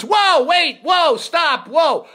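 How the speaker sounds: loud, high alarmed halt; retold panicked cameraman shout; cameraman yelling cut. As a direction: shout to stop everything mid-take. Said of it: "The cameraman goes".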